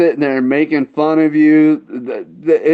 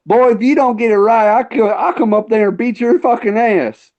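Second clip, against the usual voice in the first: higher, twangy mock threat; 7 semitones higher than usual. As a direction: ham up the angry local threatening him. Retold threat from angry redneck.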